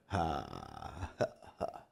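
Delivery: very low, soft, breathy laugh; mock chuckle. Preacher replaying his reply to the question, low mock chuckle. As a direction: let out slow, hushed ha-has.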